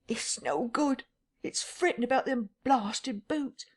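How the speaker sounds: put-on a frightened old vagrant muttering to himself; rustic tramp, rattled.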